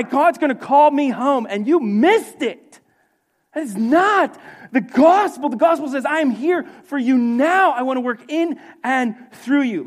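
high, loud, emphatic sermon delivery; full-force preaching. Real, not acted. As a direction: hammer each point home to the congregation.

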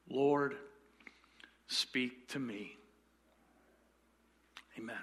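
slow, soft prayer voice. Shown as a demonstration. Pastor leading the church in a quiet prayer. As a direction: pray it quietly and slowly. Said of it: "would you pray this simple prayer".

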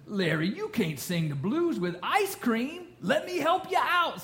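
drawn-out words climbing high at the end; hammed-up scolding helper; cartoon character. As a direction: imitate a cartoon guy offering help. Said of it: "so the guy comes up and says".

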